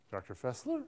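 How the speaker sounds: "Doctor Fessler" with rising inflection on "Fessler"; name given as if asking, "Fessler" lifted at the end; answer as a question. Shown as a demonstration. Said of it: "what makes it sound like a question".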